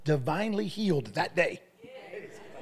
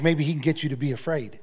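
ordinary talk

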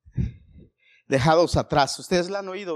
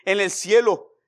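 ordinary delivery

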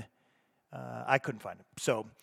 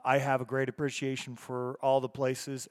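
usual speaking voice

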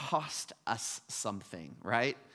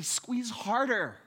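much higher, slow strained plea; dying patient begging; 10 semitones higher, 4 dB louder, slower than usual. Retold or imitated. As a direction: beg weakly for a firmer grip. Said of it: "And so they say".

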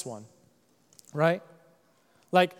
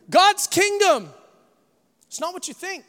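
ordinary talk

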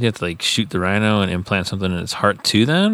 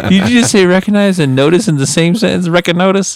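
mock disbelief; 12 semitones higher, 4 dB louder than usual. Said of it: "You're like".

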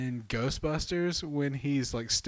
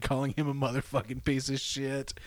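normal talking voice

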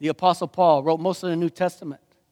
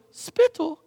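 English accent; 10 semitones higher than usual. Shown as a demonstration. Repeats the English accent joke.

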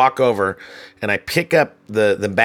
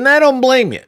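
much higher and louder declaration; emphatic; 7 dB louder than usual. Retold or imitated. Manager confronting the guest scamming a free room, emphatic. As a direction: make it a big sarcastic concession.

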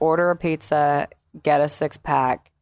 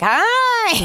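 very high, bright little hi; a tiny high-pitched hello; squeaky hi. Acted. Greeting fans in person.

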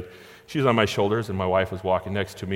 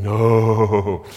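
put-on a small girl's stubborn no; a touch louder child's refusal; child refusing; 6 dB louder than usual. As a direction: refuse it like a shy little girl.

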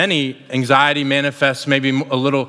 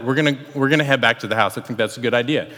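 understated, casual and even; calm; 3 semitones lower, faster than usual. Retold or imitated. Husband mentioning sharks to his wife in the kayak, calm. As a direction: deliver alarming news as a calm afterthought. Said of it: "This is really how I said it".

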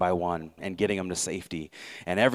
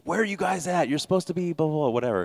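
higher, impatient officer calling the captain; officer on the radio; 8 semitones higher, faster than usual. Retold or imitated. Commanding officer radioing the late captain, impatient. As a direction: demand to know where they are.

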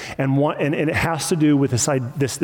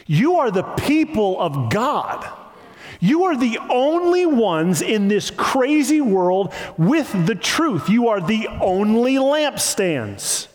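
higher, drawn-out proclamation; emphatic preaching; 5 semitones higher than usual. Real, not acted. Preacher affirms God's people to the congregation, emphatic preaching. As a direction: declare it to the congregation, stretching the key words.

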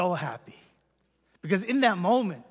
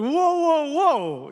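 startled whoa; 8 semitones higher, 6 dB louder, much slower than usual. Retold or imitated. Shocked dad will come watch.

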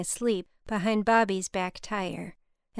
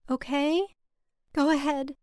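small, higher-pitched voice; tiny voice; 6 semitones higher than usual. A narrator's reading. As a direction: answer in a tiny, timid voice. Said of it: "she said in a tiny voice".

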